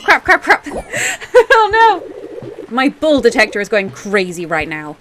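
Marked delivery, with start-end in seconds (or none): 0.0-2.7 high laugh breaking through the curses. Real, not acted. Streamer laughing at a bad draw, high and giddy. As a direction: laugh helplessly at the mess.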